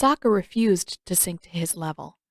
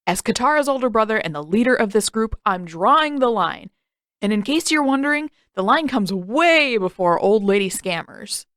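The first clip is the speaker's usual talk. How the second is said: loud, fast big-brother proclamation; pompous declaration; 3 semitones higher, 4 dB louder than usual. Acted. Sokka lectures the fortune teller, loud and pompous. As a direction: puff up and lay down the law.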